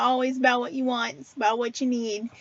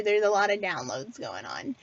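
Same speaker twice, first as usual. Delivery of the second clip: real choked-up apology, sinking softer and lower; 5 semitones lower, 4 dB quieter than usual. Real, not acted. Host apologizing to viewers as downloads come through, choked up. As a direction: apologize while getting choked up. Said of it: "I'm getting all choked up here".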